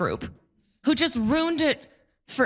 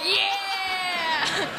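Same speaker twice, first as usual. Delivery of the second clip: crowd cheer; 9 semitones higher than usual. Retold or imitated. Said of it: "everybody's like".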